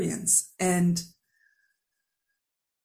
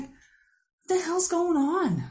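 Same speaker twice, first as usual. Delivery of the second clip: puzzled inner voice; 8 semitones higher, much slower than usual. Retold or imitated. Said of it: "Now I'm just kinda like".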